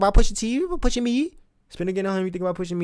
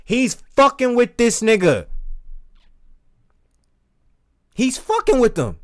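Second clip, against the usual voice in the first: excited; 8 semitones higher, 6 dB louder than usual. Real, not acted. Excited reaction to the diss.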